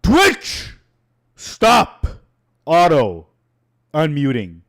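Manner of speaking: real fed-up outburst, pitch leaping up; exasperated yell. Real, not acted. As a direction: yell it word by word at the misbehaving website. Fed up with Twitch auto-unmuting.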